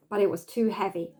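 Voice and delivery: ordinary delivery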